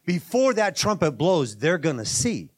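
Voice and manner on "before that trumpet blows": normal talking voice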